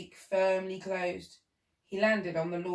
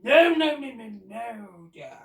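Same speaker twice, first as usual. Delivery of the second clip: shout; 8 dB louder, slower than usual. Acted. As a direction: cut her off sharply. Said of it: "shouted the Duke".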